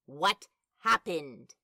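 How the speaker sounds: usual speaking voice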